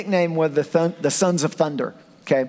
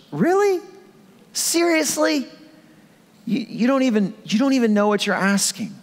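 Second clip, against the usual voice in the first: much higher, incredulous; high-pitched disbelief; 7 semitones higher than usual. Retold or imitated. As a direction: squeak out the disbelief. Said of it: "Like in a high-pitched".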